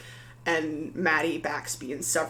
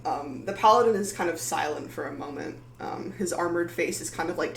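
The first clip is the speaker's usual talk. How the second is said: ordinary talk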